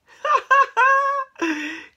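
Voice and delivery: high, loud cackling laughter; acted uproarious cat laughter; loud mocking laugh. A narrator's reading. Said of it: "made the cats laugh even louder".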